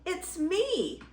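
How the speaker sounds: tiny, high-pitched goat voice; squealing baby goat. Acted. Baby Gruff answering the troll, high little squeal.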